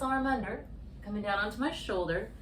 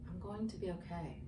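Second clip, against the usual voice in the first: softer, gently offered self-reassurance; soft mantra; 2 semitones lower, 9 dB quieter than usual. Shown as a demonstration. Yoga teacher models the calming mantra for the viewer, softly. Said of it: "Reminding yourself".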